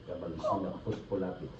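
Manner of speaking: very soft, low ritual words; barely audible deep prayer; murmured incantation. Real, not acted. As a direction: mutter a secret spell. Healer recites incantation.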